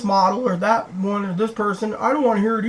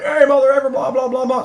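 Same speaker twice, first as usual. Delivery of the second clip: higher, louder mock yell at the trucker; imitated screaming; 8 semitones higher, 6 dB louder than usual. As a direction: retell his yelling at the driver, hammed up. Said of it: "screaming at the trucker".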